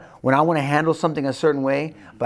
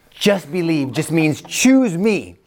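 ordinary delivery

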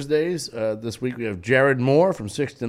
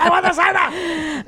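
much higher, slow, hammed-up demand; pirate voice; 4 dB louder than usual. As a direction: whine for cider like a cartoon pirate. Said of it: "that's why I was doing that voice".

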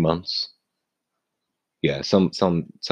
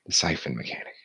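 low, much softer mumble; odd low voice; 7 dB quieter, faster than usual. Shown as a demonstration. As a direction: mutter it low and soft. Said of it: "I don't know why I'm talking like this".

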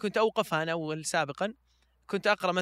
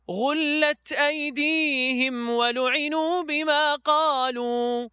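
high, louder slow chant; raised voice for the reply; 8 semitones higher, 5 dB louder, much slower than usual. Shown as a demonstration. Reciter showing how the divine reply rings out, louder and higher. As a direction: lift the voice as if answering them. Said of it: "ثم الرد تقوله بصوت عالي".

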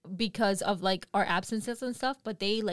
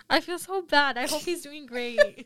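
real laughter breaking through higher, louder words; laughing guilt; 7 semitones higher, 7 dB louder than usual. Real, not acted. Telling her friends about insulting a customer, laughing through it. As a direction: laugh while admitting you feel bad.

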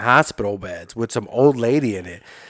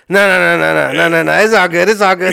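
higher, louder, eager repeated dismissal; drunk eager brush-off; 5 semitones higher, 5 dB louder than usual. Retold or imitated. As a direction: wave off the warnings, drunk and keen. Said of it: "But I'm drunk and I'm horny and I'm high and I'm like".

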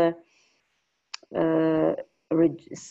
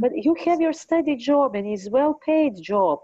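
quoting mom; 7 semitones higher than usual. Retold or imitated. Mom quoted, high voice.

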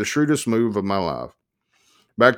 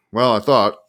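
ordinary delivery

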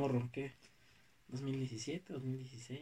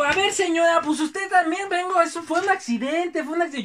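heated protest; 9 semitones higher, 7 dB louder than usual. Retold or imitated. He argues with the lady.

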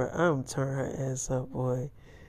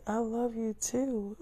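drawn-out sweet reply, pitched high; high, slow girlfriend voice; 7 semitones higher, 7 dB quieter, slower than usual. Retold or imitated. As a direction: let her linger on it softly. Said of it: "It's like".

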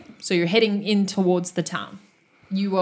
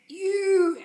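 a high, strained cry while falling asleep; sputtering foiled villain; 11 semitones higher than usual. Acted. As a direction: spit it out while losing consciousness. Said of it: "and he's like".

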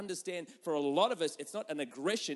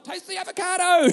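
higher, loud, laughing through the wife's line; laughing mock demand; 10 semitones higher, 10 dB louder than usual. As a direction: deliver the punchline while cracking up. Wife pops up snorkelling, punchline.

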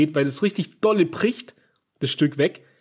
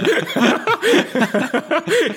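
squealing laughter, much higher; 11 semitones higher, 3 dB louder than usual. Real, not acted. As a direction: break into a high laugh. Laughing at friend's story.

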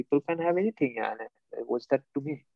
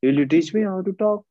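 sarcastic mock request; 5 semitones higher, 5 dB louder than usual. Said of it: "I said".

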